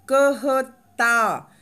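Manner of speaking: कहता spelled out very slowly and loudly, each syllable apart; slow, clear syllables. Shown as a demonstration. Teacher reading कहता slowly for beginners. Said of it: "इसको हम मुंह बंद करके पढ़ेंगे".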